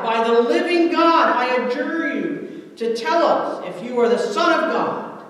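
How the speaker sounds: loud, high-pitched cry of demand. A narrator's reading. High priest demanding an answer from Jesus, crying out. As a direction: cry out the oath with full force. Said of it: "the high priest cried out".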